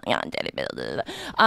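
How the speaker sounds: breathy whisper of the lyric; half-whispered singing along. Retold or imitated. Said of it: "and I was like".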